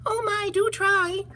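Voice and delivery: very high, slow tiny fly; high little fly plea. Acted. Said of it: "said the fly".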